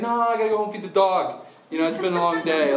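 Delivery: high, loud mock reluctance; feigned excuse. Jesus pretending he must go, hammed-up excuse-making. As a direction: beg off with a comic made-up excuse. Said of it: "pretending like he has to go somewhere else".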